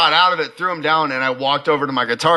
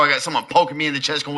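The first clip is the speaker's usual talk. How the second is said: ordinary talk, nothing marked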